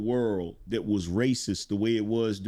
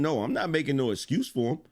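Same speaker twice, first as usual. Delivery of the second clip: usual speaking voice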